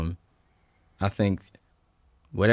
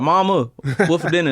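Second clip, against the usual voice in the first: put-on high, loud Southern US kid voice; childhood Southern accent; 9 semitones higher, 7 dB louder than usual. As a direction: imitate your little-kid Southern twang, calling out to mom. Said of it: "when I was young, I used to talk like this".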